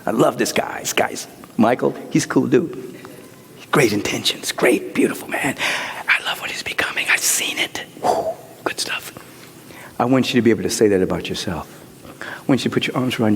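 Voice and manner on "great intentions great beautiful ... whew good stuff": soft, intimate whisper; whispered praise. Acted. Preacher blessing Michael, in a tender whisper. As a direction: whisper it close, with affection.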